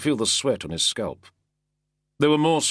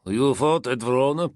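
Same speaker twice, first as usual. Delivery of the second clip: lightly voiced flat Musian drawl; hint of a flat Musian drawl; 5 semitones higher, 3 dB louder than usual. A narrator's reading. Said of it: "in his flat, Musian drawl".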